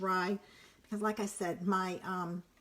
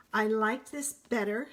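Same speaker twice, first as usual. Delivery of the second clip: ordinary delivery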